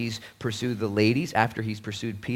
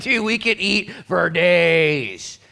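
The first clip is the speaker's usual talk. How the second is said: exasperated parent; 8 semitones higher, 9 dB louder, much slower than usual. Retold or imitated. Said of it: "It's like".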